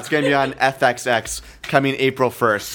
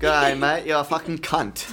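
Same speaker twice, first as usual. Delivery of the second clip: imitating a comic's Australian accent, higher; cheerful mock Australian accent, pitched up; 3 semitones higher than usual. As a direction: put on a playful Australian accent. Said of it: "with his Australian accent".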